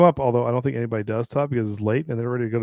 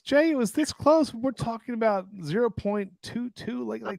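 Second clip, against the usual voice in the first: much higher, slow teasing squeak; mock high squeak; 10 semitones higher than usual. Real, not acted. Teasing Jay about how close it was, high squeak. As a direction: squeeze the voice up small.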